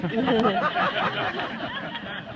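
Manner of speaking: loud open laugh. Real, not acted. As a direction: burst into real laughter. Laugh after the joke.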